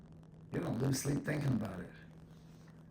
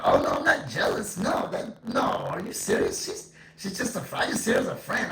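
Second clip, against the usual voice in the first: much higher and louder, flustered protest; mock jealous denial; 8 semitones higher, 8 dB louder than usual. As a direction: play the friend insisting too hard it's nothing. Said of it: "They go".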